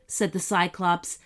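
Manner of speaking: plain reading voice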